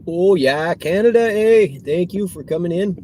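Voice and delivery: high, loud hammed-up Minnesota "Canada, eh?"; mock Minnesota accent. Driver greeting a Canadian rider in a hammed-up Minnesota accent. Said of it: "that was too Minnesota".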